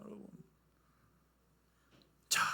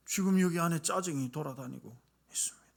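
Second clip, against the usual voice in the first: whisper; 8 dB quieter than usual. Real, not acted. Sermon emphasis whisper.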